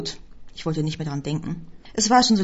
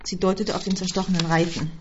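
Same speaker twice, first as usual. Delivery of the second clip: plain reading voice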